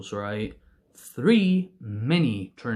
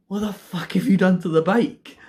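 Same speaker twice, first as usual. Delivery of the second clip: higher, incredulous retold demand; mock outraged dad; 5 semitones higher, 4 dB louder than usual. Dad seeing the wrecked bike, mock outrage. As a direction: lightly imitate a parent's outburst.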